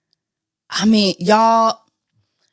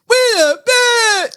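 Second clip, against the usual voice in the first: much higher, slow stretched hype call; 12 semitones higher, slower than usual. Retold or imitated. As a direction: boom it out like his ad-lib. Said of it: "You be loud as hell on everybody record".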